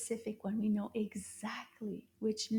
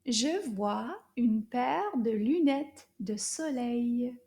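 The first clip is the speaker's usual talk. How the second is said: slow, clear, a little louder; slow repeat model; 4 dB louder, slower than usual. Shown as a demonstration. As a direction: model it again for them to echo.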